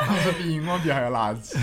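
laughing while teasing; words broken up by laughter. Real, not acted.

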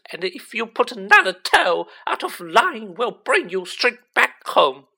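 loud, high, outraged scolding; full-force angry tirade, last words slowed; furious yelling. A narrator's reading. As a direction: shriek it like an enraged mother. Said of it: "the voice that was making his eardrums throb".

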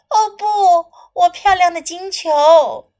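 high, loud dismayed princess; storybook princess in distress; cartoon princess. Princess line in translation.

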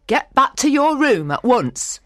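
a touch of anger, firm command; stern order. A narrator's reading. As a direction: send the child away sharply. Housekeeper orders Mary away.

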